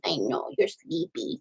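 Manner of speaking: hushed coo to the sleepy pup; soft cooing. Real, not acted. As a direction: murmur gently so he can rest.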